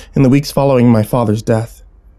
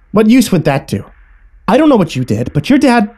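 high, agitated scolding; berating mother; 10 semitones higher, 3 dB louder than usual. A narrator's reading. Mother dismisses child's plea for sister, berating. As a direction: lash out as the mother, pitch climbing.

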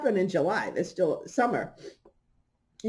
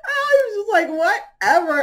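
laughing; 10 semitones higher, 4 dB louder than usual. Real, not acted. Laughing dismissal.

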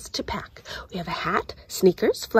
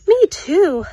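put-on higher, louder girl; Samantha's voice; 9 semitones higher, 9 dB louder than usual. Samantha agreeing about the heat, higher and louder. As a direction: chime in brightly.

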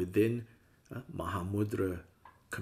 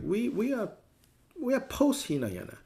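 much higher, smug and superior; mock smug teacher; 12 semitones higher than usual. Mocking Vajrayana teachers who dismiss Hinayana, in a much higher, smug voice. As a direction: preen like you're above it all. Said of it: "They're like".

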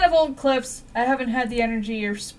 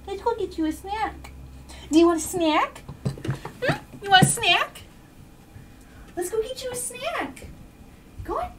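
squeaky praising a dog; 8 semitones higher than usual. Real, not acted. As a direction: squeak at the dog about a snack. Baby talk to dog.

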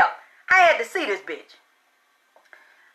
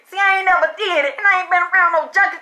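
normal talking voice

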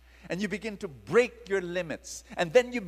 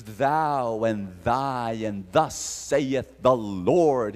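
lower, slow, grand biblical intoning; old English solemnity; 6 semitones lower, 6 dB louder, much slower than usual. Pretend. Preacher parodying old English prophecy, lower and slow. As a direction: intone it like the King James Bible. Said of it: "he did not speak in old English".

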